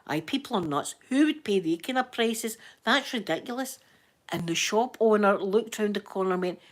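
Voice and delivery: normal talking voice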